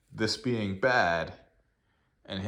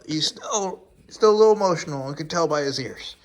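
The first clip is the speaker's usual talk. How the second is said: much higher, louder, fast cooing; 8 semitones higher, 10 dB louder than usual. Real, not acted. Owner soothing the dog, high fast cooing. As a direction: coo over the upset pup.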